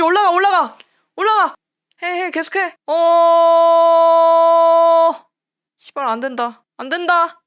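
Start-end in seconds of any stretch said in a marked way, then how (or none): 0.0-2.8 loud, high urging; 다급한 재촉. Real, not acted. Urging players forward, live attack. 2.9-5.1 long, loud held scream of alarm; one sustained cry at sudden danger. Real, not acted. Scream at last-minute danger.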